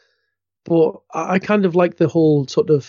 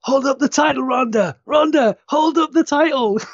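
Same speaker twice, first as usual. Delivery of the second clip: imitated crew shout; 8 semitones higher than usual. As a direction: call out like an off-camera crew member. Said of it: "someone on the crew shouting".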